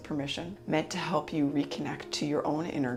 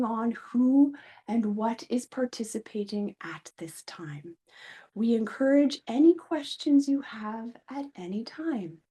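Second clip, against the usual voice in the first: higher, measured channeling voice with swelling emphasis; channeled collective of guides; 8 semitones higher, 6 dB louder than usual. Pretend. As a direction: speak as otherworldly beings addressing an audience through a vessel. Let me drop in.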